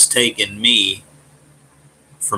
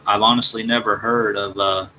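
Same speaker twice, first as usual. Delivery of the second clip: normal talking voice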